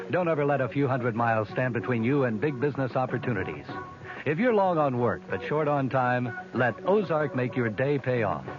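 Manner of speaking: normal talking voice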